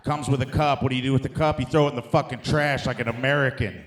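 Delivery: ordinary delivery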